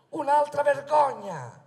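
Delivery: loud slow indignation; indignant. Acted. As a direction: spit out the disgrace. Indignant rally speech.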